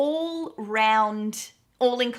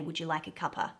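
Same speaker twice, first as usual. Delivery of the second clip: soft offer; 5 semitones lower, 7 dB quieter, faster than usual. Retold or imitated. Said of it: "they would specifically say".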